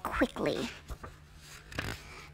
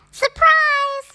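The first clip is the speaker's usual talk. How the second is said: squeaky, very high, loud; mice shouting surprise; 7 dB louder than usual. A narrator's reading. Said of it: "cried the mice".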